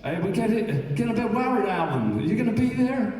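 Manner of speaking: high, loud, mock-worried American; worried friend's message. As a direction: imitate a friend checking in anxiously. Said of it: "another message".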